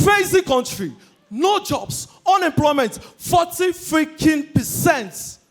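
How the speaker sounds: ranting at the crowd about unemployment; shouting rant. Real, not acted. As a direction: yell it in disbelief.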